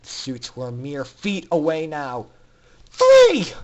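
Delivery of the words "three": high, full-voice battle cry; shout. A narrator's reading. Fillies launching the escape on the count, huge shout. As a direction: scream the signal to charge. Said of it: "A collective shout reverberated".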